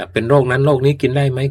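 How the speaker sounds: high and loud rhetorical question; emphatic sales question. Real, not acted. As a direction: hit it hard like a pitchman. Host poses rhetorical ad question.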